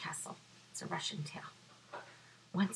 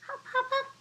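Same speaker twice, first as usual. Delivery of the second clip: bouncy hop sounds; faster than usual. Acted. Bunny hopping.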